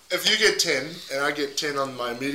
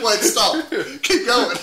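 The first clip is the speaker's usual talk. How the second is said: higher, louder, laughing imitation; laughing mock callout; 6 semitones higher, 5 dB louder, slower than usual. Friend mimicking the roadside warning, laughing mock callout. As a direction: call it out through laughter. Laughing retelling of the acted hail.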